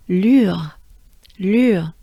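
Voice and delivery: "lure" loud, long French u falling into a French R; sustained U. Shown as a demonstration. Teacher shows how they form together, sustained U. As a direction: hold the u in "lure", then let it drop. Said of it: "we do sustain the U a little bit".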